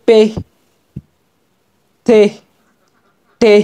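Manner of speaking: পে, তে, টে each ending in a breathy h; aspirated ending. Shown as a demonstration. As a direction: push a puff of air out at the end of পে, তে, টে. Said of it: "কিরকম উচ্চারণটা হবে". (পে, তে, টে-র শেষে হাওয়ার ধাক্কা)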